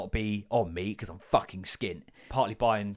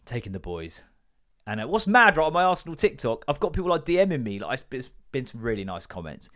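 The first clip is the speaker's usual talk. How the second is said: usual speaking voice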